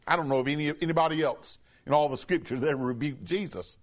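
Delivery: normal talking voice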